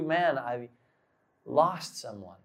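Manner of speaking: low, soft, understated sadness; subdued sorrow. Retold or imitated. Voicing listeners who had a hard year, quietly sorrowful. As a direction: drop the voice and confess it quietly.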